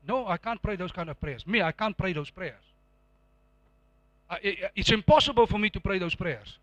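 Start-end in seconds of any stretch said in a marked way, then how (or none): none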